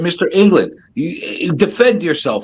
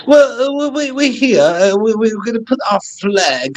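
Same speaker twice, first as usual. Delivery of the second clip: higher-pitched mock British colonizer accent; hammed-up British accent; 4 semitones higher than usual. Playing a British colonizer claiming land, hammed-up British accent. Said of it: "Now you're shit again".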